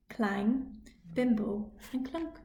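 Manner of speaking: ordinary delivery, nothing marked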